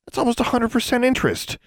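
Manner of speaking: high, outraged exclamation; indignant shock. A narrator's reading. Girl shocked at the hag's interest, indignant. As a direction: balk at the deal.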